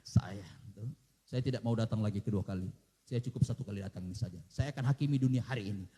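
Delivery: low, stern judge's voice; mock-grave Lord declaring doom; stern Jesus. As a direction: pronounce judgement from above. Imagined Jesus refusing the cross.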